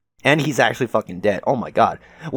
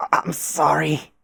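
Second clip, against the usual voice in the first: higher, slow, drawn-out sorry; pained apology; 5 semitones higher, much slower than usual. Acted. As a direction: stretch out a remorseful apology. Acted apology in tragic scene.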